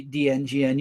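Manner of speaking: ordinary talk, nothing marked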